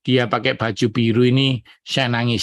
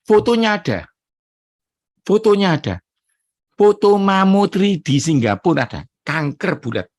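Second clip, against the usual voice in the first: much higher and louder, exultant; triumphant proclamation; 7 semitones higher, 4 dB louder than usual. Real, not acted. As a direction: proclaim the proof to the crowd. Triumphant preaching of the miracle.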